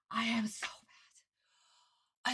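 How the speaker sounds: slow, whispery fuming; holding back anger. Real, not acted. Fan who must not scream tells viewers, fuming under breath. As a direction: mad but unable to scream, squeeze it out slowly. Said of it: "I can't fucking scream".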